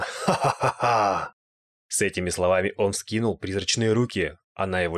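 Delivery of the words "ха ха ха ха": slow, gloating villain laugh; triumphant ghost cackle. A narrator's reading. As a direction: laugh slowly in mock triumph. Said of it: "призрак победно прокаркал". (торжествующий смех призрака)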